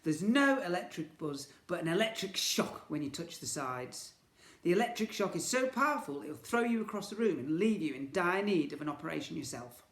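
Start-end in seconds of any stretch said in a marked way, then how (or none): none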